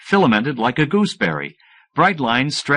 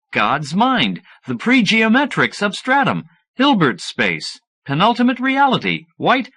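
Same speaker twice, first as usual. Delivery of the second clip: lightly voiced Harry, higher and rapt; a hint of an eager know-it-all friend reeling off wonders; 9 semitones higher than usual. Harry dazzling Fletcher with names for superspace, lightly raised voice.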